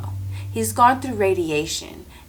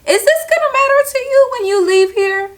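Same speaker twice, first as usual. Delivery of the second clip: pointed question; 7 dB louder than usual. Retold or imitated. Asking coworker pointed question.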